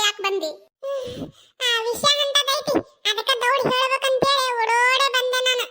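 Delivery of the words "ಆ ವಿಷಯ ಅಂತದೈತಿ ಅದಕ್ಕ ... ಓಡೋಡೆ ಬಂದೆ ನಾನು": out of breath. Acted. Messenger, breathless from running, explains his hurry. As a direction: gasp it out after the run. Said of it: "ನಾಯಿಗತ್ತೆ ತೇಕಾಕತ್ತಾನ".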